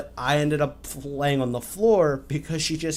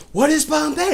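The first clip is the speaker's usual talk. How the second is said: much higher and louder, rising in disbelief; shrill baffled question; 7 dB louder than usual. Retold or imitated. Friend demands an answer from the teasing boyfriend, pitched up high. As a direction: squeal the question at the friend who won't stop. Girl baffled by repeated word.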